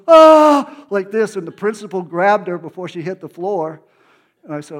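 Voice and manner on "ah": sharp high loud gasp-cry; mock cry out. Teacher crying out as she falls to the floor, high loud cry. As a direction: burst out like you're being struck down. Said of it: "And she goes".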